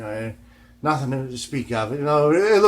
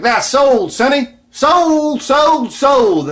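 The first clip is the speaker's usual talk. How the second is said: put-on much higher, louder old farmer insisting it's sold; 14 semitones higher, 10 dB louder, much slower than usual. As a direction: hammer each sold like a cranky old man. Old farmer refuses to sell.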